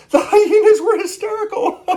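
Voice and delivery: squealing high, loud through giggles; hysterical laughing. Acted. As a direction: break up laughing like a hyena. Laughing hyenas in the story.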